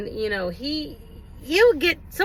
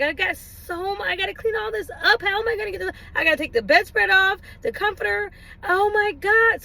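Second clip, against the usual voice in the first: higher, dramatic fuss over the mess; exaggerated fretting; 6 semitones higher than usual. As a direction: overplay the panic on purpose. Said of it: "I was over-exaggerating on purpose".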